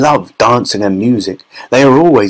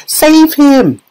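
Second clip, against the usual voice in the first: very high panicked scream; frantic high-pitched cry; Missus Gloop; 4 dB louder than usual. A narrator's reading. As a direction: shriek for someone to rescue your son. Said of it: "screamed Missus Gloop".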